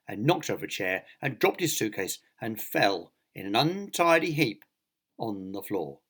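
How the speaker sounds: ordinary delivery, nothing marked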